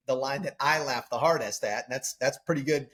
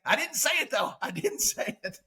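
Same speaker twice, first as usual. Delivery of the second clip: higher, laughing put-on voice; high mock voice; 9 semitones higher than usual. Retelling the scene to friends, high silly voice. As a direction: quote him in a silly high voice. Acting the retold movie quote.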